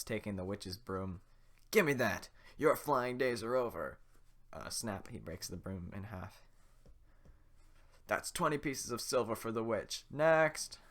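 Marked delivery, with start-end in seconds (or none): 1.7-4.0 louder, sneering guard; gloating guard. Acted. The guard gloats at the witch, louder. 8.1-10.8 guard calling out. Acted. Guard pays and calls.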